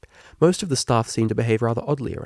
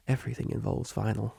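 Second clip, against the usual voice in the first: glum murmur; 8 dB quieter than usual. Acted. Octavia glum confession.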